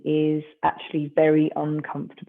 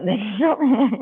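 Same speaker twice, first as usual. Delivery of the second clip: higher, louder, breaking into laughter; laughing; 6 semitones higher, 6 dB louder than usual. Real, not acted. Exercise teacher amused at own Australian slip, laughing. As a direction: laugh through the instruction.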